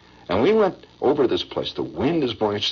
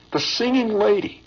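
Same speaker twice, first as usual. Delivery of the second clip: higher, surprised naming of her; excited recall; 10 semitones higher than usual. Retold or imitated. Old soldier marvels at the singer, excited. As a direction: marvel at who it was.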